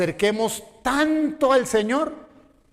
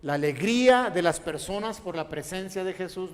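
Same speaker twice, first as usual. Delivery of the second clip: normal talking voice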